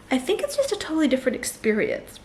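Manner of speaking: usual speaking voice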